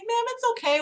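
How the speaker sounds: high, soft reassurance; gently calm an upset older caller, pitched high; soothing. Retold or imitated. Acts out soothing the old lady.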